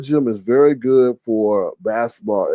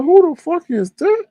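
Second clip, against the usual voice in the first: high, slow outraged squeal; much higher, slow stunned cry of who is that; shocked disbelief. Retold or imitated.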